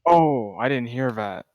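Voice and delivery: ordinary talk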